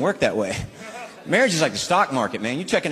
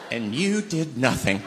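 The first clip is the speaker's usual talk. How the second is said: slow accusing wife; 3 dB quieter, slower than usual. Acted. Wife retelling dream accusations.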